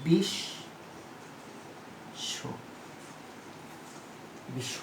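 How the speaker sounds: বিশ্ব said as "bishsho", the ব not sounded; pronunciation demo. Shown as a demonstration. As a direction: model বিশ্ব slowly as "bishsho". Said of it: "কি দাঁড়াচ্ছে".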